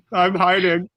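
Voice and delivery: talking through a mouthful; words around a bite of food; mouth full. Real, not acted. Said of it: "I talk with my mouth full".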